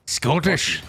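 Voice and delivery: Scottish accent. Shown as a demonstration. Answering friend who is not Scottish, mock brogue. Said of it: "But I'm not Scottish".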